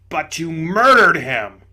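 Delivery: loud, gruff ghost exclaiming; indignant big ghost. Acted. Big ghost to the bright spirit, loud indignation. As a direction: blurt it out loudly, amazed and offended.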